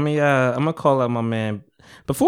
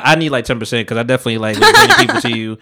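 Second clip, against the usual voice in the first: usual speaking voice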